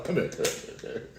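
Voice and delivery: hushed aside to a friend; secretive whisper. Acted. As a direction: whisper it so no one hears. Peter whispering aside to Jesus.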